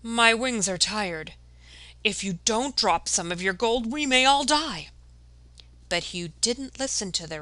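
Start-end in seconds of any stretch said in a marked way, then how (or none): 0.0-4.9 a tired bird begging, then panicking; weary raven. Acted. Raven pleading with greedy Hugh.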